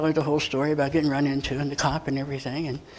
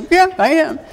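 defiant retort; 12 semitones higher, 7 dB louder than usual. Retold or imitated. Retold reply to dispatcher.

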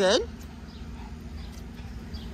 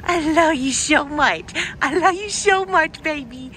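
sweet nothings; 6 semitones higher, 4 dB louder than usual. Acted. Cooing at the dog.

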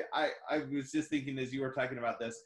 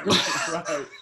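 loud real laugh; shared loud laugh; burst of laughter; 4 semitones higher, 10 dB louder than usual. Real, not acted. Both laugh at YouTube joke.

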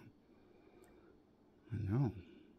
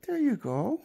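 talking to a dog; 9 semitones higher, slower than usual. Real, not acted. Giving dog a treat.

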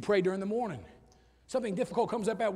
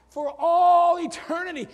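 loud, high, slow proclamation; exultant preaching; 7 semitones higher, 9 dB louder, slower than usual. Real, not acted. Preacher promising lasting joy to believers, exultant. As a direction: stretch it out and ring it through the room.

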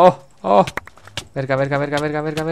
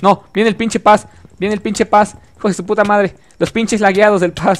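exasperated; 6 semitones higher than usual. Real, not acted. The laggy player Paz approaches again.

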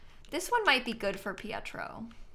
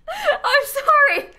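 high, loud, drawn-out cry through laughter; laughing apology; 10 dB louder, much slower than usual. Real, not acted. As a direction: laugh helplessly as you apologise. Laughing apology over repeated yawning.

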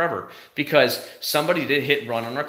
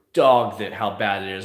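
ordinary talk, nothing marked

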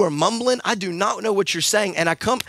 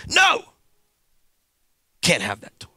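parent refusing. Retold or imitated.

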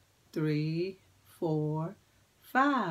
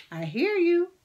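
excited praise; 9 semitones higher, 7 dB louder than usual. Acted. Praising the kids' singing.

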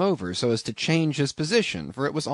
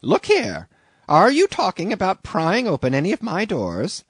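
higher, louder indignant innkeeper; indignant landlady; 7 semitones higher, 6 dB louder than usual. A narrator's reading. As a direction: scold the young man for prying.

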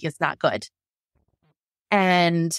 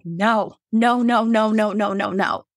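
mild retold scream; 2 semitones higher, 3 dB louder, slower than usual. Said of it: "I felt like I was screaming it".

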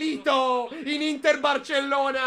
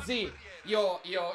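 ordinary talk

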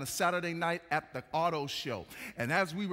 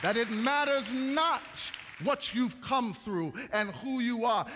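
raised, ringing sermon climax; impassioned preaching; 5 semitones higher, 3 dB louder than usual. Real, not acted. Preacher telling the congregation they are valuable, impassioned preaching. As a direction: preach it at full force, higher pitched.

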